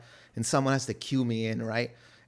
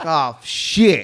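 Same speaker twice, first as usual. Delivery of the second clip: alarmed; 4 semitones higher, 10 dB louder than usual. Retold or imitated. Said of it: "So I'm like".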